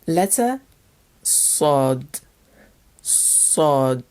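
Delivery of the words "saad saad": Sod with a heavy, dark S; emphatic S. Shown as a demonstration. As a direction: say Sod low, mouth barely open, leaning on the S. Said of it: "a very emphatic S sound".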